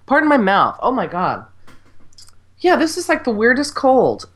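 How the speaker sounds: ordinary talk, nothing marked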